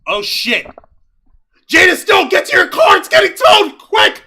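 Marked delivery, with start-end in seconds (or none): 1.7-4.2 mock urgent shout.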